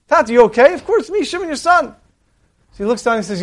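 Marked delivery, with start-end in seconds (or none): 0.1-2.0 much higher, pleading cry up to the window; desperate pleading. Acted. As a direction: beg the father to recognize him.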